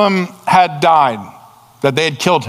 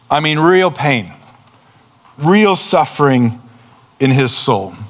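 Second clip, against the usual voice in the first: normal talking voice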